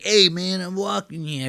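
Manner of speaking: put-on quick caricature of a New York accent. Streamer compares regional accents, mock New York voice. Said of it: "People in New York, they're like".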